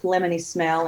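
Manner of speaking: usual speaking voice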